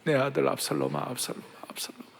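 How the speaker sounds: soft lament fading into a breathless whisper; hushed weeping lament. Acted. The preacher voicing David weeping for his son, hushed. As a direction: let the grief fall away to nothing. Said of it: "그렇게 울면서 통곡하면서 했잖아요".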